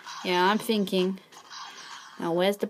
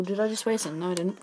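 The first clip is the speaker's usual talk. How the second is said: ordinary talk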